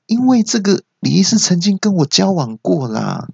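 high-pitched, heated declaration; insistent nurse voice. Acted. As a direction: blurt it out like the worked-up nurse. Nurse indignantly reveals the relationship. (学护士高声激动地辩解)

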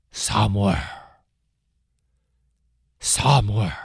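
low, distant call of God; God calling Samuel in the night, low and distant. Acted. God calls the boy again.

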